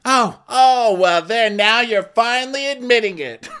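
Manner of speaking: high, loud gotcha; hammed-up triumphant squeal; mock gotcha squeal. Rinna catching Garcelle's admission, high mock squeal. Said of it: "recap quote".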